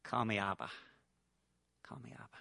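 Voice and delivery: soft plea falling to a whisper; fading whisper. Acted. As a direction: let it fade into a whisper. God's gentle invitation, fading.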